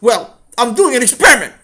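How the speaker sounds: put-on high, loud child's announcement; exasperated outburst. Sammy finally explains himself to his father, shouting. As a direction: burst out with the explanation.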